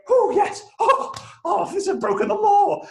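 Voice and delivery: high, loud squeal of relief; giddy triumph. Retold or imitated. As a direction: celebrate getting away with it, high-pitched. Retold glee at sneaking in.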